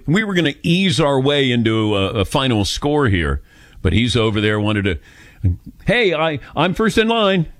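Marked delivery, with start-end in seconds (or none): 5.9-7.5 higher-pitched mock eagerness; a hint of an eager queue-jumper; lightly mimicked eager caller. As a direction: pipe up to claim your place, lightly mimicked. Mimics eager caller claiming first spot.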